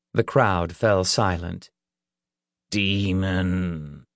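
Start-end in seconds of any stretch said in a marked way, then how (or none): 2.7-4.0 stern, drawn-out summons; low, stern. A narrator's reading. Reverend addresses the demon, low and stern. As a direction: call it out low and grave. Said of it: "called in a low, stern voice".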